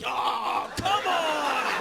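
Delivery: high, drawn-out groan of protest; hammed-up exasperated outburst; mock exasperation. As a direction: whine it like a huge imposition. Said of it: "Would you be like".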